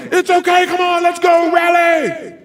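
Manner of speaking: high, loud rallying shout; fan cheering. Acted. Fans cheer after a wrong-way ball, rallying shout. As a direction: holler support from the stands. Cheering despite a bad play.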